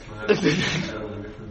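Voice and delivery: soft breathy laugh to oneself; helpless little laugh. Real, not acted. Teacher amused at their own productive study day, soft laugh.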